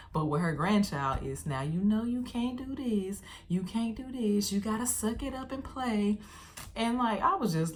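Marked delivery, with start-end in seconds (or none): 1.5-4.5 higher, coddling grandma tone; sweet little voice. Retold or imitated. Grandma to her grandchild, mock sweet babying. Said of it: "no little sweet little voice". 4.5-6.2 higher, coaxing grandma tone; sweet little voice. Retold or imitated. Grandma nudging grandchild to play, mock sweet babying. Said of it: "no little sweet little voice".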